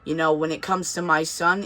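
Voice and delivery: ordinary delivery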